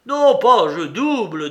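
loud, high burst of refusal, then settling; forceful, impulsive objection; defiant refusal. A narrator's reading. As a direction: insist on raising the bet. Gambler refuses to stop.

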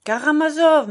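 loud, high correcting cry; exasperated correction. A narrator's reading. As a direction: call out the right name forcefully. Correcting mother's mistaken name.

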